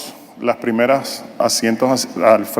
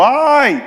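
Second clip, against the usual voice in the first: sharp high yelp of pain; comic high "ay" of pain; mock cry of pain; 13 semitones higher than usual. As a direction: yelp as if touching a sore spot. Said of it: "gritó".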